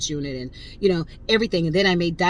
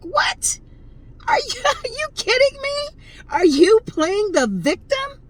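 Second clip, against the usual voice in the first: incredulous; 5 dB louder than usual. Retold or imitated. Incredulous retort to screaming woman.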